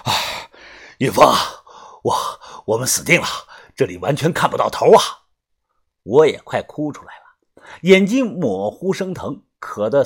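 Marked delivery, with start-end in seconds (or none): 0.1-5.0 panting between words; gasping, broken phrases; out of breath. A narrator's reading. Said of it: "喘着气说道".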